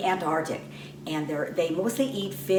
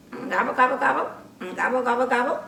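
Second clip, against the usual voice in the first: a touch higher gobbling turkey call; turkey gobble; 4 semitones higher, 3 dB louder, much faster than usual. Acted. Turkey puppet showing the children its gobble. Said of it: "show 'em your gobble".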